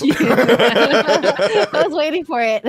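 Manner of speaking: real laugh spilling into the words. Real, not acted. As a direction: burst out laughing, then speak. Laughs at the awaited answer.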